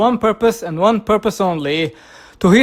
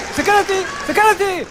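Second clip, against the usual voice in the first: panicked call; 8 semitones higher, faster than usual. Acted. Calling for help.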